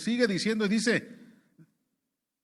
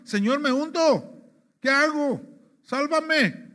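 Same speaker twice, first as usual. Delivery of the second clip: loud, high cry for rescue; acted panicked shout, stretched out; desperate shouting; 6 semitones higher, 5 dB louder, slower than usual. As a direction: yell it as if you are going under. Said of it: "empezó a gritar, hermano, con la desesperación".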